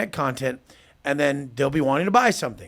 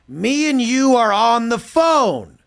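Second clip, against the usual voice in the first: higher, louder and slow, spelling it out; exasperated loud repeat; 7 semitones higher, 5 dB louder, slower than usual. Retold or imitated. Telling a confused client on the call, loud and slow. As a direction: drag each word out as if to a slow child. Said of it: "I'm like".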